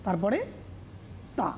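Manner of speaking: teacher sounding out each syllable of সহযোগিতা; syllable-by-syllable demonstration. Shown as a demonstration. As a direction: break সহযোগিতা into separate syllables with long pauses. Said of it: "কীভাবে উচ্চারণ করব".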